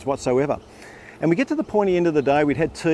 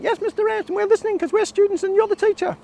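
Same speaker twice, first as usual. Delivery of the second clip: put-on squeaky high, eager boys' chorus; a keen ten-year-old reciting back; much higher, cheerful kid voice; excited kids. Boys answering the teacher.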